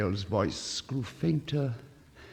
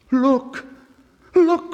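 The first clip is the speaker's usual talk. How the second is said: high, loud joyful cry; tree crying out; 10 dB louder than usual. A narrator's reading. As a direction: call it out to the whole garden. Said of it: "cried the tree".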